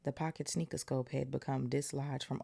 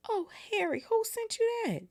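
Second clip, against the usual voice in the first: put-on excited girl; 5 dB louder than usual. Hermione voiced in story.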